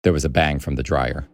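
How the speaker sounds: ordinary delivery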